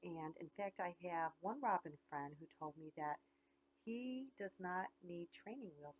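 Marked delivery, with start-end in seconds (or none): none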